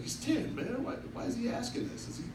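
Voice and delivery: half-whispered alarm at the question; shocked. Retold or imitated. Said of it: "I was shocked".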